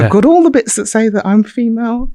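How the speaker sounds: high, loud, indignant protest; upset insistence. Retold or imitated. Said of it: "really getting upset".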